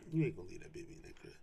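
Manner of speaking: quick hushed whisper, insistent; fast whisper. Acted. Speaker to other parents about crying babies, fast firm whisper. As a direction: drop to a fast whisper to make the point.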